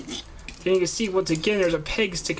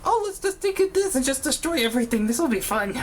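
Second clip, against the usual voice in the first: much higher, gleeful maniac; crazy voice; 9 semitones higher than usual. Retold or imitated. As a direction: play it like a giddy lunatic. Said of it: "just plain crazy".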